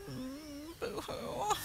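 soft, pretend boo-hoo; play-cry quietly for children; mock weeping. Inviting children to weep along, pretend crying. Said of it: "Can we hear some weeping".

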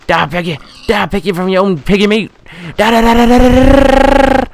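high loud frenzied yell; shouting. Real, not acted. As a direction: scream it while attacking. Yelling attack on pig.

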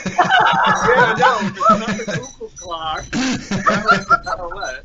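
high, giggling through the words; laughing. Real, not acted. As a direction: laugh it out, amused. Laughing about the bird clock.

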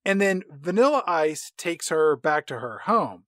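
normal talking voice

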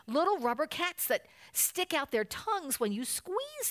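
high, eager cub voice; mildly shouted childlike excitement; excited shout. A narrator's reading. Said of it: "shouted the cubs again".

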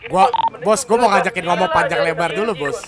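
loud, insistent push to talk. Real, not acted. Crew leader demanding to speak first, loud and insistent. Tense negotiation turns heated.

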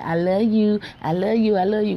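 mock affectionate. Showing viewers what her family never says, mock affectionate.

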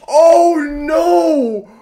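high pained wail; anguished cry. Real, not acted. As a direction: cry out as the chance goes. Fan reacting to a miss.

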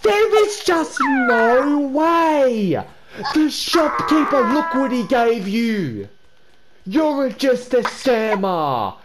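high, slow, drawn-out indignant scolding; hammed-up disbelief at a scammer; overacted betrayed shout; mock outrage. Outraged at friend's scam.

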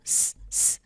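voiceless hiss স, স; just a whispered hiss of s. Shown as a demonstration. Teacher hissing স for viewers to copy. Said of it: "এস যেভাবে উচ্চারণ করি".

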